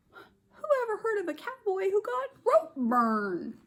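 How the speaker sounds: lightly voiced boy. Avery's refrain, voiced.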